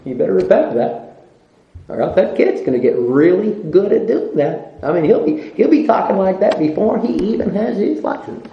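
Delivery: ordinary delivery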